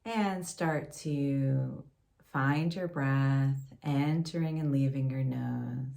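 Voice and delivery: calm, unhurried meditation voice with long pauses; guided meditation. Acted. Yoga teacher leading a gratitude meditation for listeners, guided meditation. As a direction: gently guide them inward, leaving space between phrases.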